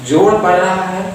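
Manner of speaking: ordinary talk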